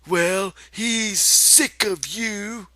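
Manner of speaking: high, very slow taunt; taunting rabbit. Acted. The rabbit taunts Lenny, slow high taunt. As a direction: draw it out cruelly.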